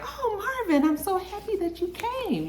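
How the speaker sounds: mock-sweet high-pitched greeting; gushing woman impression. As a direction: put on a sugary, delighted falsetto. Voicing delighted Miss Renee.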